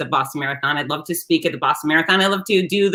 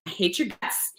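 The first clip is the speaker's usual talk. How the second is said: softly muttered, half-hearted fury; retold fury, kept under the breath; mock fury; 2 semitones higher, 9 dB quieter than usual. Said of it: "So in my fury".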